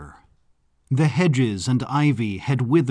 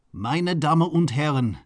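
lightly pinched, formal butler; a slightly raised, pinched tone for the butler; 3 semitones higher than usual. A narrator's reading. Butler formally welcomes guests to the castle, lightly pinched voice. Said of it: "said the butler in a pinched voice".

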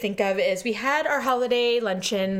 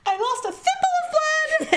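high, slow melodramatic wail; mock hysteria; slower than usual. Teasing friend over a small cut, hammed-up high wail. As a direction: overdramatise a tiny injury, laughing. Listener mocks the teller's panic.

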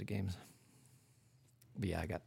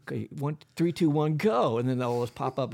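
ordinary talk

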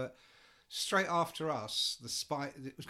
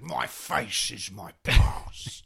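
tough guy quote; 2 semitones lower, 10 dB louder, much slower than usual. Retold or imitated. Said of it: "He goes".

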